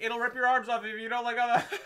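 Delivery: high yelled warning breaking into laughter; hammed-up high warning; mock shouted warning. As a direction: call it out high, half laughing. Acting out warning in storm.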